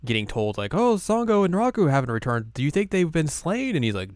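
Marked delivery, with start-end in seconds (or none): 0.7-3.7 high-pitched, anxious imitation; mock fretful messenger. Servant asking the manor lord about the missing, high fretful voice. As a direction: report it in a high, worried put-on voice. Acted worried report to the lord.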